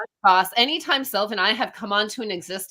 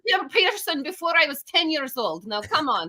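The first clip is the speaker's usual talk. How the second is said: put-on Russian accent; 7 semitones higher than usual. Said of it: "started speaking in a Russian accent".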